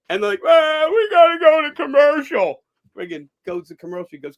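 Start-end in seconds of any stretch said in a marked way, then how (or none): 0.4-2.6 host voiced. Host voiced in retold story.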